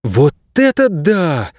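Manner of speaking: put-on high, slow girl's wow; amazed exclamation. A narrator's reading. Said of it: "воскликнула Кейса".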